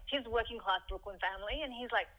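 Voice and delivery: normal talking voice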